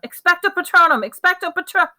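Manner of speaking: high, loud yelled incantation; yelled spell. A narrator's reading. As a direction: cast it with a full yell. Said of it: "Harry yelled".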